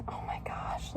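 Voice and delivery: soft, whispered in wonder; awed whisper. Real, not acted. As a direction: breathe it out, amazed. Admiring tattoo close up.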